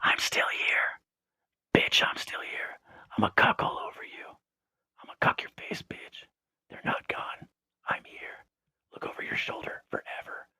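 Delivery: slow, barely audible whisper; creepy whisper. Pretend. Popping back in to say "still here", creepy whisper. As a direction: whisper it like someone lurking close by. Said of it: "[name] was whispering".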